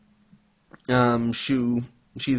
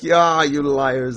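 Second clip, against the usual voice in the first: drawn-out, louder mock outrage; mock pissed off; 3 semitones higher, 5 dB louder, much slower than usual. Granddaughter scolds grandpa for faking death, mildly pissed off. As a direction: lightly imitate an indignant accusation. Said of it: "she gets pissed off".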